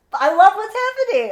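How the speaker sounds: real laugh, high and loud; giddy delight breaking into laughter. Real, not acted. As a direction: gush through a laugh. Gleefully laughing about the story.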